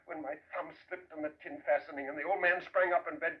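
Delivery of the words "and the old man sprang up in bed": ordinary delivery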